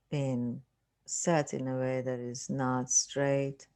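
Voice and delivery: unhurried meditation voice with long pauses; guided meditation. Acted. Teacher leading listeners through relaxing the body, guided meditation. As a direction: calmly guide them through a body scan, leaving space.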